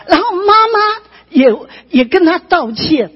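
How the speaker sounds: high, loud testimony delivery; impassioned preaching. Real, not acted. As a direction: preach the turning point with rising fervour. (高亢激昂地讲道)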